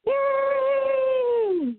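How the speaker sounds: usual speaking voice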